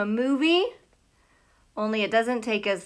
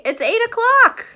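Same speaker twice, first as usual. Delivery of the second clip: ordinary talk, nothing marked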